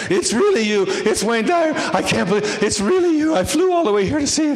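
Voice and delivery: put-on high, excited Dutch-accented rush; thick Dutch accent, breathless. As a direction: gush it in a Dutch accent, barely pausing for air. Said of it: "she's all out of breath".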